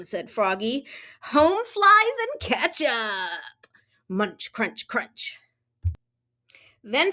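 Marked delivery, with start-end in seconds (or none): 1.3-3.7 slow, savoring high voice; Froggy. Acted. Froggy describes his breakfast, slow and delighted. 4.1-5.4 soft crunching chew noises; fading munching. Acted. Froggy eats breakfast, crunch fading to a whisper. Chewing sounds.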